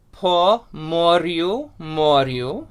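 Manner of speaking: "po moryu, moryu" slowly, with rolled R's; Ukrainian pronunciation. Shown as a demonstration. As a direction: model "moryu" slowly, rising at the end. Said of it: "the words of the first line are".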